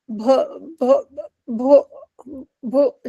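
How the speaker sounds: halting repeats of the first sound "भ-"; acted stutter on "भ-"; stammering. A narrator's reading. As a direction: get stuck on "भ-", pausing between tries. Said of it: "she began to stammer".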